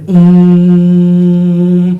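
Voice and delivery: long, loud nasal hum "امم"; drawn-out hum. Retold or imitated. Speech interrupted by a loud mysterious hum. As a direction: hold a slow, loud "امم". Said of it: "اب مجھے آواز آئی".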